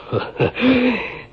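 a sudden, very high falling cackle; mocking cackle. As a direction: cackle at the old man's fear.

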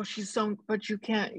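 usual speaking voice

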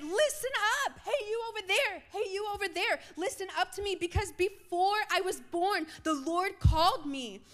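high-pitched, eager proclamation; acted excited call-out. Preacher voices Isaiah proclaiming his calling, excitedly calling out. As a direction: holler it with excitement. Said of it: "full of the excitement".

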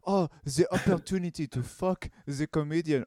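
hammed-up French accent, swooning; fancy French waiter. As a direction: gush like a snooty French waiter. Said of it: "like a fancy French, like, waiter's like".

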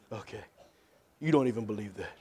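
low, soft scoffing aside; hushed, doubting dismissal; dismissive scoff. Acted. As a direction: quietly brush off someone's faith talk. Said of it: "they're laughing like".

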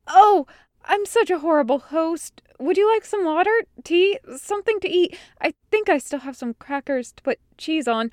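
high, hurried burst of offers; flustered hostess Meryl. A narrator's reading. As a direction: start with a loud gasping realization, then ramble apologetically. Meryl flustered, remembering to host.

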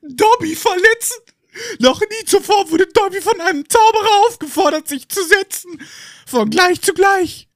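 very high, loud sobbing elf voice; Dobby sobbing with gratitude. Acted. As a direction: sob it out, overcome with emotion. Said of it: "Der Elf schluchzt".

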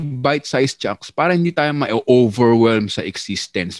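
ordinary talk

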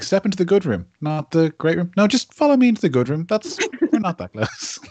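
high-pitched, pointedly polite brush-off; put-on high hostess voice, keeping them at a distance; politely snubbing host. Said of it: "letting someone you don't think very much of them".

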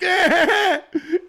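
pathetic begging whine, imitated; hammed-up high whimpering noise; high mock whimper. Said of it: "Bro was out here like".

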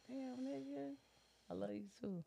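high, soft, choked-up whisper of love; teary reply. Retold or imitated. Mother tells her son she loves him back, teary and soft. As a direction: answer through tears, voice breaking high. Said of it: "I'm bawling, crying again".

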